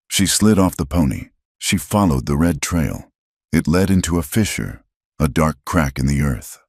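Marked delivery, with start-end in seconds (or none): none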